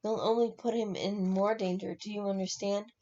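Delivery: ordinary delivery, nothing marked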